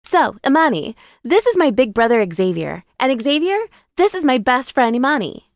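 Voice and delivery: high, bubbly best-friend voice; high-pitched character voice with a sly smile in it; Jada introducing. A narrator's reading. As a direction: pitch it up like an excited hostess making introductions. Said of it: "Jada introduces us, a sly smile on her lips".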